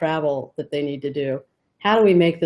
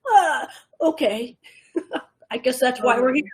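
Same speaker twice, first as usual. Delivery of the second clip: mock reluctant resignation; 7 semitones higher than usual. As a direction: give in reluctantly, playfully. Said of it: "It's just like".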